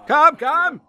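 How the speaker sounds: a loud impatient hurry-up call; loud taunting call. Acted. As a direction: call out loudly and high, urging.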